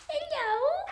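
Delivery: very high little coo. Real, not acted. Cat sitter breaking off to greet a kitten, high baby talk. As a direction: greet a tiny pet sky-high.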